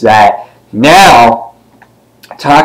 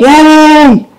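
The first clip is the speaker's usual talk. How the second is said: very high, drawn-out squeal of joy; mock cheer; 3 dB louder than usual. Imitates Venom cheering.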